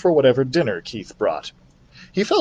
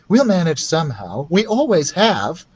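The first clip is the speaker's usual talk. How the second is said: corrected voice; 5 semitones higher than usual. Acted. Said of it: "wrong voice".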